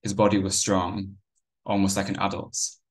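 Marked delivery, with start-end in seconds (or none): none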